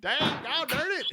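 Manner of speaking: put-on high, loud old-timer cussing; old prospector. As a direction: ham up a cranky old prospector. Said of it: "an eighty-year-old prospector".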